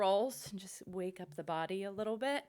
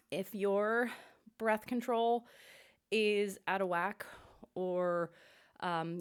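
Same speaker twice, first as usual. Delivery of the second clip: normal talking voice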